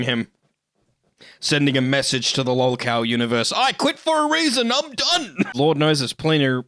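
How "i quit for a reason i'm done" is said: high squeaky puppet-master voice; puppeteered voice. Pretend. Rat inside his hat voicing the quitter, high and squeaky. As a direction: pipe it up high like a tiny rat pulling his strings. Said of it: "I'm actually inside his hat right there ... controlling him".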